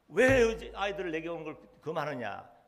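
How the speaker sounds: a preacher's voice booming out Jesus' rebuke; Jesus rebuking his disciples, said loud and firm. Retold or imitated.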